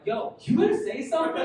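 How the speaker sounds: high, questioning call to a lifeguard; alarmed appeal. Retold or imitated. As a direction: call out for someone to step in.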